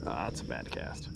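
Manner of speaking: whispered, to himself; whispered grumble. Real, not acted. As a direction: breathe it out, annoyed. Muttering at own bad cast.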